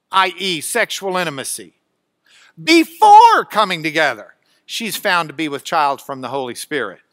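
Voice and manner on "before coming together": "before" very loud, second syllable punched; emphatic opening word. Shown as a demonstration. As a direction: hit "before" hard, then let the phrase fall. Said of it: "Greek word order for emphasis".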